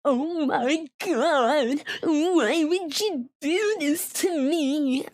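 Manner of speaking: hammed-up fake crying, high and slow; whiny fake sob voice; mock crying. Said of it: "like, crying and stuff".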